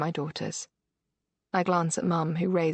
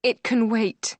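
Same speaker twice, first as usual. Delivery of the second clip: firm; 5 semitones higher, 5 dB louder, slower than usual. A narrator's reading. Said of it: "I try to sound as firm as I can".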